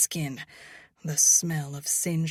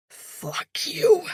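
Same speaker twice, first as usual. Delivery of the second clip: growling; 8 dB louder than usual. A narrator's reading. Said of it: "he growls".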